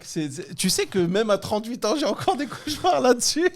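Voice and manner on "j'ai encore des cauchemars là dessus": laughing. Real, not acted. Laughing about his exam nightmares.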